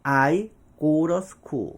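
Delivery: slow "I go to school", "to" flapped to "ro"; T를 리을로 바꾼 발음. Shown as a demonstration. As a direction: say "to" as a tapped "ro", word by word. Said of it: "리을까지 갑니다". ('to'를 '러'로 굴려 천천히)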